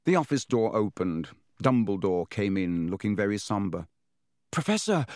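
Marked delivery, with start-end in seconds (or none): 4.5-5.0 put-on high, alarmed boy's exclamation; gasped. A narrator's reading. Harry greets headmaster after bird burned, gasping. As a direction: gasp it out in alarm. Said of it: "Harry gasped".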